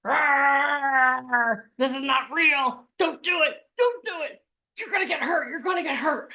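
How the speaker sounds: high, anxious monster warning with a growl; growl, then warn fearfully; the fear monster warning the audience, high and anxious; fear guy voice. Retold or imitated. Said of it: "you have the fear guy".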